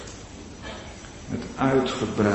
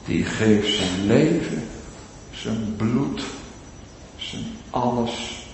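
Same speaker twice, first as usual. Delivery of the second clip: ordinary talk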